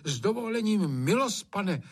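deferential servant speaking up, pitched high; daring to object. A narrator's reading. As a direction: politely dare to interrupt the master. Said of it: "opovážil se Hajný nemlčet".

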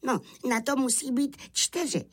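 high-pitched lamb voice; explaining little lamb. A narrator's reading. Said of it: "vysvětloval beránek". (beránek vysvětluje vysokým hláskem)

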